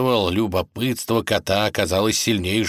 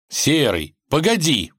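higher-pitched call to hold on; stopping someone; 8 semitones higher, 3 dB louder than usual. A narrator's reading. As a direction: call out to halt a friend. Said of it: "остановил его царевич".

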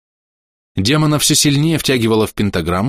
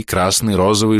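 plain reading voice

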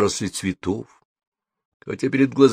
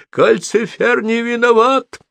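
high-pitched, drawn-out protesting cry; shouting in protest; 13 semitones higher, 3 dB louder than usual. A narrator's reading. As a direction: cry out high in someone's defence. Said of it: "закричала Софи". (кричать, высоко и протестующе)

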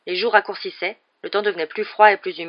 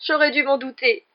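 high, tearful lament; acted sob, pitched up; tragic sobbing; 7 semitones higher, 3 dB louder than usual. A narrator's reading. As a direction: wail it like a tragedy. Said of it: "sanglota Lavande d'un air tragique".

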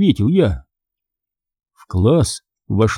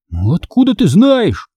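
high-pitched challenging question; defiant; 8 semitones higher than usual. A narrator's reading. Boy doubting his friend's claim, defiant. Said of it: "вызывающе спросил Конор".

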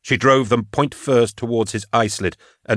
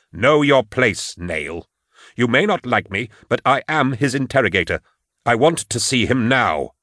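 raised, insistent voice of authority; pulling rank; 3 semitones higher than usual. Acted. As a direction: demand access, drawing out the opening order. Interrogator pulls rank on guard.

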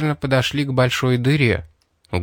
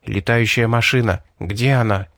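ordinary delivery

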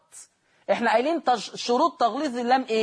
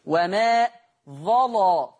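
ordinary talk

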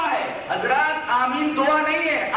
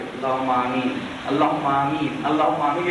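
low, monotone repeated chant of a prayer; mock supplication; 12 semitones lower than usual. Shown as a demonstration. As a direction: drop far down and intone it like a rote prayer. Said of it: "کہ آپ یوں دعا کیجئے".

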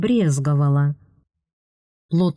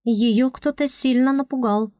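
high, light, calmly reasoning voice; spirit girl voice; 7 semitones higher than usual. Acted. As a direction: voice a cool-headed spirit girl, pitched up. Tuesday voiced again.

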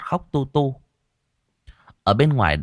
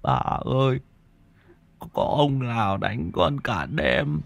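put-on whimpering child; 3 semitones higher, slower than usual. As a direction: whimper to grandma like a sick little boy. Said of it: "nó nhìn bà mếu máo".

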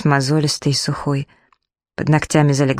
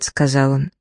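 plain reading voice

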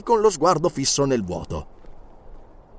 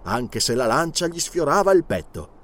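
ordinary delivery, nothing marked

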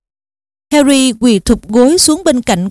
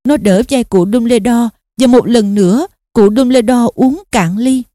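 plain reading voice

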